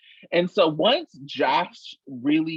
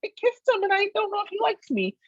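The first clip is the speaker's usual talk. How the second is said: mocking whine. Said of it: "the hijinks they get up to is not".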